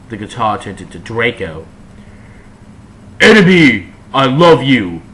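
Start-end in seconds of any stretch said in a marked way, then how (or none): 3.2-5.0 louder sad cry; loud lovesick call; shouted sadly. A narrator's reading. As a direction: cry it out in longing. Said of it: "he shouted sadly".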